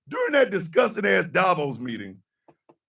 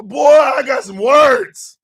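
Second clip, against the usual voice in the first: loud slow shout; 7 semitones higher, 11 dB louder, slower than usual. Real, not acted. To the cohost, a loud slow shout. Building to a rant.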